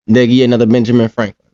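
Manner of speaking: ordinary talk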